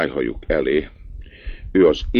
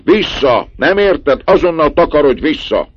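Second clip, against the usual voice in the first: very high, loud angry shout; man yelling at a peasant boy blocking the road; furious yelling; 13 semitones higher, 8 dB louder than usual. A narrator's reading. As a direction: drive the lad off the road. Yelling at the cart driver.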